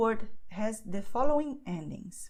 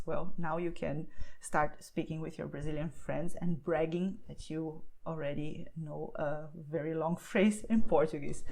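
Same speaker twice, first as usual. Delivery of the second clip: ordinary talk, nothing marked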